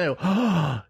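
ordinary talk

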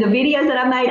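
normal talking voice

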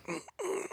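put-on high strained whimper of a toddler; soft high mock whimper; imitating straining. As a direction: squeeze out a tiny pained mm mm. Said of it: "And he's just like".

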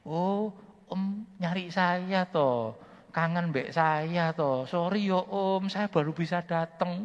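high, slow, bright-voiced hello to the old uncle; cheerful greeting to a sick man. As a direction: coax him warmly, drawing the words out. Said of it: "saya bilang begini".

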